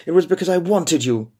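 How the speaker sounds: pleading. Acted. Heroine pleading to husband.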